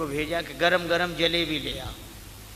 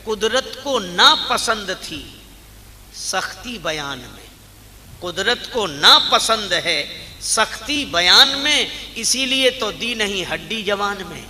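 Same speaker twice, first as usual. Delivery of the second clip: loud, ringing couplet recitation; raised, measured preacher's declamation; declaimed verse in preaching; 4 semitones higher, 8 dB louder than usual. Real, not acted. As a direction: declaim the poet's lines with force.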